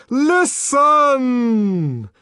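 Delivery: very high, loud, drawn-out call; startling high clarion command; trumpeting. A narrator's reading. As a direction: blare it like a trumpet, held even longer. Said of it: "trumpeted the voice".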